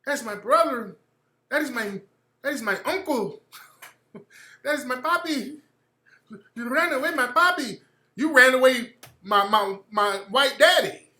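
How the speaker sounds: mock whiny relative. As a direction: ham up a clingy relative missing papi. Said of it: "they were like".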